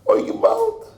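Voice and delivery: anguished wail, pitched very high; crying out. Acted. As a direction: cry out in despair. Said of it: "and he's crying".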